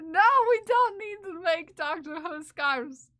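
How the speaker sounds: loud, high whiny protest; mock exasperation. Streamer rejecting viewers' scarf request, mock exasperation. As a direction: shoo them off with playful indignation.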